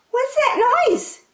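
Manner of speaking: very high, slow mouse squeak; little mouse hearing a noise, squeaking high; squeaky mouse. A narrator's reading. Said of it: "he squeaked".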